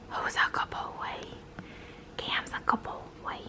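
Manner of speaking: slow soft whisper to a pet; whispering to a dog. Real, not acted. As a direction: breathe it gently to the dog.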